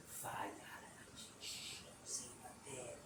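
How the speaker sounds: slow, hushed whisper; conspiratorial hushing under the breath; secretive whisper. Pretend.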